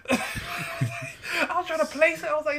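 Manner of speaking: usual speaking voice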